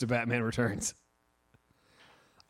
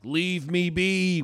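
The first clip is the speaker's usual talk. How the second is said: drawn-out, higher mock wail; imitated kid yelling; 4 semitones higher, much slower than usual. Retelling a schoolboy flailing at helpers, drawn-out mock yell. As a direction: stretch each word in a mock yell. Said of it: "flailed his arms and was like".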